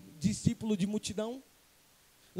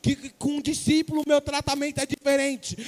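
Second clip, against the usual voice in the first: loud, raised-pitch preaching building to a shout; preaching at full force; 4 semitones higher, 11 dB louder than usual. Acted. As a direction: drive each repeated phrase harder. (pregação inflamada, quase gritando)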